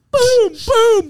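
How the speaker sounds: high-pitched cocky finger-gun noises; Shooter McGavin. Retold or imitated. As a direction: crow it like a showboating golfer. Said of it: "been doing Shooter McGavin".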